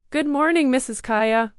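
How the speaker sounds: loud class greeting in unison; shouting together. A narrator's reading. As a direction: call it out together, loud and high. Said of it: "shouts the students all together".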